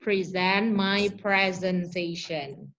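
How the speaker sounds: slow, careful model sentence. Shown as a demonstration. Teacher modeling for the class, slow and careful. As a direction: spell out each word slowly for the students. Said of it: "if it is a verb".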